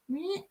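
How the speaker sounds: small high, quiet squawk; attempted eagle cry. Shown as a demonstration. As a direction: try an eagle noise, feebly. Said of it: "eagle type noise".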